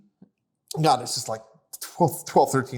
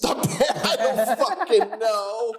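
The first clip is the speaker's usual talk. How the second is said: laughing; much slower than usual. Real, not acted. Laughing after story.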